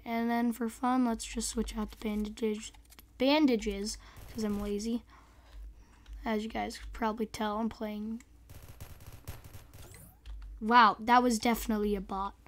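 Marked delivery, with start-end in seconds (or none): none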